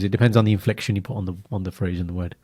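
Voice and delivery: normal talking voice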